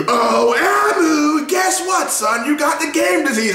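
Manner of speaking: high, shouted taunting villain; gloating villain. Retold or imitated. Scumbag villain taunting Emu, high gloating shout. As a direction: taunt him like a sore loser. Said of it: "he was like".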